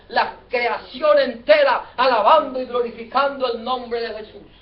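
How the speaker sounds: loud, exultant pulpit proclamation; rousing preacher's shout, stretched on the acclamations; full-force preaching. Real, not acted. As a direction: cry out the praise to the whole congregation. Preaches the triumphal entry at full force.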